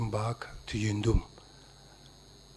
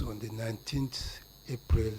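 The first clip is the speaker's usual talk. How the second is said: barely audible; 8 dB quieter than usual. Real, not acted. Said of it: "Could you kindly speak up".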